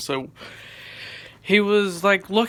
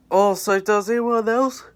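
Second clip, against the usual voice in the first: louder, higher salesman hype; hyped scammer pitch; 6 semitones higher, 6 dB louder than usual. Retold or imitated. Scammer luring the lobby with an unreleased skin, loud hype. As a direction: bait the lobby like a pushy hype man. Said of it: "he was like".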